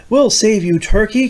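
high loud rallying cry; barnyard cry. Acted. The barnyard animals crying out to save the turkey. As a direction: call out to rescue. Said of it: "came the cry from the barnyard team".

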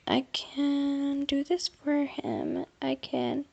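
slow hushed whisper; slow whispered self-talk. Real, not acted. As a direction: murmur it slowly to yourself. Quiet self-talk while building.

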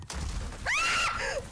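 a jump-scare yelp of fright; startled scream. Real, not acted. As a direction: streamer screams as an enemy jumps her. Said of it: "sorry for the scream".

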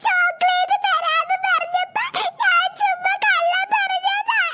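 put-on high, slow, sobbing child's voice; a child crying as she admits she lied. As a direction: wail it out like a scared little girl. Child crying, confessing the lie.

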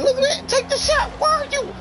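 high falsetto quote of his own game chatter; mocking his own callouts. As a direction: parrot the teammate pleas in a high cartoon voice. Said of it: "that's all I be saying".

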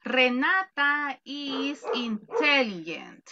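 slow, careful example sentence; slow model sentence. Shown as a demonstration. Teacher slowly modelling an English sentence for students. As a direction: spell it out slowly for the class. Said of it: "Cuál es la diferencia si yo digo".

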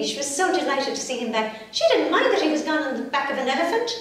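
plain reading voice

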